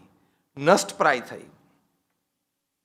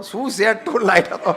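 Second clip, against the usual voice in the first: mock nitpicking. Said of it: "સહેજ tone dark હોત ને".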